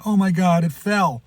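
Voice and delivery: high, loud panicked shout; retold scream. Lady in the parking lot screaming at the falling dog. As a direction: shriek it like a stranger who saw it fall. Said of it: "a lady out in the parking lot screaming".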